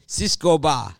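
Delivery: mock Spanish street vendor calling out "Cisco ba"; heavy Spanish accent. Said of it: "but with a heavy Spanish accent".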